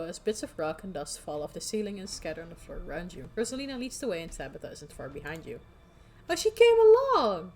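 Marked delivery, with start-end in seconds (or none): 6.3-7.5 high, loud, slow exclaim; mock delight. Real, not acted. Player mocking the companion who followed, high gush. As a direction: gush over it.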